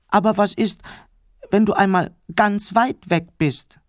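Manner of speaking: put-on little boy peeping from under the covers; piping little boy. A narrator's reading. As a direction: pipe it up like a worried little kid. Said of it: "piepste der kleine Clemens".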